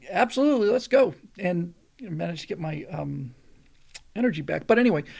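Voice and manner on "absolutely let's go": imitating a game, raised-pitch yes; eager reply. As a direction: jump in louder, ready to roll. Eager reply to crew when tired.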